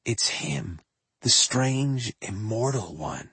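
soft, reverent near-whisper; hushed tones. Pretend. Far-future listeners murmuring about the singer, hushed and reverent. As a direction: breathe it in awe of a legend. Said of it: "saying in hushed tones".

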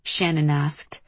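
plain reading voice

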